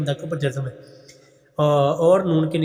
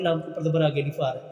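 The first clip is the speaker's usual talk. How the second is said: usual speaking voice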